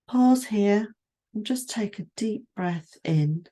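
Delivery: guided meditation. Acted. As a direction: ease the listener down through the body, unhurried. Leads a standing body scan.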